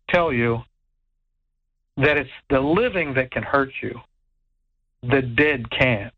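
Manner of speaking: ordinary delivery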